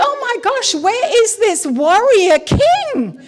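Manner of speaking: very high, drawn-out disbelief; mock dismay. Reader appalled by the whinging king, mock dismay. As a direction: exclaim in exaggerated incredulity.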